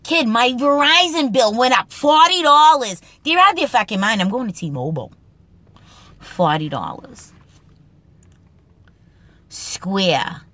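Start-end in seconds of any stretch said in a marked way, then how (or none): 0.1-5.1 higher, louder Boston rant; a Boston guy ranting about his Verizon bill, in a loud Boston accent; angry Boston accent. Pretend. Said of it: "Boston Speak segment, Kid lines".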